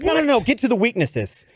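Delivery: high, impatient boss cutting in; impatient interruption. Retold or imitated. Boss interrupts candidate reading his horoscope aloud, impatient. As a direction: imitate a boss waving away the preamble.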